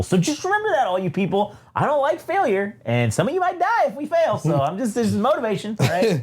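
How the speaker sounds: high, mock-menacing boss voice; hammed-up threat to the players; mock villain speech. The narrator hams up a boss threatening his crew.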